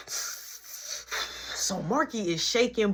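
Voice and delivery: ordinary talk, nothing marked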